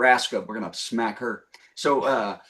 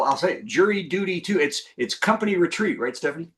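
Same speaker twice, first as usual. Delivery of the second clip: ordinary talk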